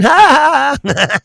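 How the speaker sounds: loud, high mock "ha, ha, ha"; hammed-up laughing outburst; mock laughter. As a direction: imitate a crowd's big laugh. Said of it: "they're all laughing".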